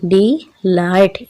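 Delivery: डिलाइट very slow, with a gap between डि and लाइट; syllable by syllable. Shown as a demonstration. Teacher repeating डिलाइट to show two syllables, syllable by syllable. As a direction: draw out both syllables of डिलाइट separately. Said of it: "इसके टू syllables हैं".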